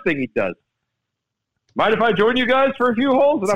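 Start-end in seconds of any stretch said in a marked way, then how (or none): 1.7-3.4 higher, brighter put-on voice of a famous golfer; Tom Watson impression. Telling friends how a golf star asked to join them, in a raised imitating voice. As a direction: pitch it up like a legend inviting himself along. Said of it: "without at least giving your impersonation".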